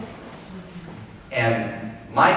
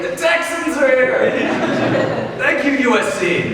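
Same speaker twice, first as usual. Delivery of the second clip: mock hype cheer; 13 semitones higher, 4 dB louder than usual. Imagined hype welcome chant.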